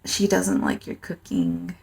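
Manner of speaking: soft, slow, dry jab; deadpan tease. Retold or imitated. Kyo teasing the little Tengu, softly deadpan. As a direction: mutter it to needle the kid.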